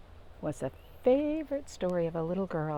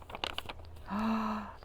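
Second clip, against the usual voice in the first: sharp inhale; 3 semitones higher, 7 dB quieter than usual. A narrator's reading. Before the sneeze.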